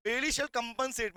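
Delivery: slow, deliberate reading of the law text; slow dictation. Shown as a demonstration. Teacher dictating slowly to students. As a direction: dictate each word slowly to the class. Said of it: "reading the provision".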